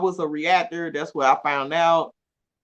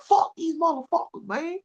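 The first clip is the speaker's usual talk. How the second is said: mock defiant; 11 semitones higher than usual. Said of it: "I come in there like".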